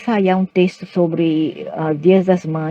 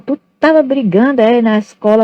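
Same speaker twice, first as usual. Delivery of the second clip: higher, louder parental interrogation; questioning mother; 6 semitones higher, 4 dB louder than usual. Retold or imitated. Mother questioning child home from school, imitated sharp inquiry. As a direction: imitate a mother demanding an answer. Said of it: "minha mãe falava".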